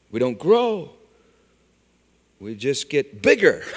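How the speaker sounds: ordinary delivery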